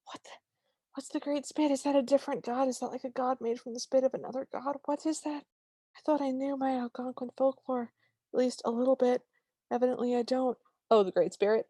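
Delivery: confused disbelief. Retold or imitated. Reading the captions aloud, baffled and high. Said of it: "it did crack me up 'cause I was like".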